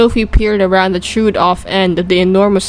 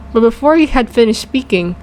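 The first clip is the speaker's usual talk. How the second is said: ordinary delivery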